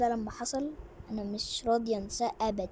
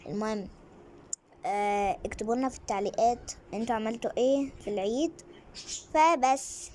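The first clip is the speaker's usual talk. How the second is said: usual speaking voice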